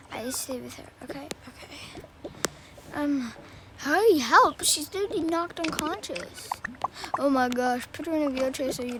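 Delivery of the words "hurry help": loud call for help; urgent cry of help; shout for help. Acted. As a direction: shout it out in a panic. Calling for help at hospital.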